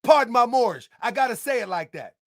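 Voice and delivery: ordinary talk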